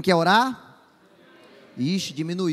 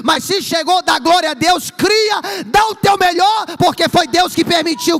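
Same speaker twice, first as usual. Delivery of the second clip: shouted, high-pitched praise call; preaching at full force; 8 semitones higher, 10 dB louder than usual. Acted. Preacher urging the church to praise, full-force shouting. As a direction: fire up the congregation at the top of your voice.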